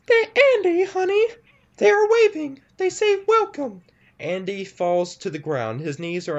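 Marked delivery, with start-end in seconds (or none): 0.1-3.8 very high, sweet-creepy monster mother. Acted. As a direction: coo like a ghoulish mom calling her son. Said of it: "opens its mouth again and says".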